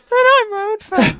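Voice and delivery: imitating a very high, unbroken voice; absurdly high-pitched self-parody; squeaky high voice. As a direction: shoot way up into a slow, squeaky falsetto. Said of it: "It's so high".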